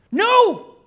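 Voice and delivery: very high, loud shriek of refusal; anguished shout. Acted. Preacher as Smeagol rejecting Gollum's accusation, shrieking. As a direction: scream it in a creature voice.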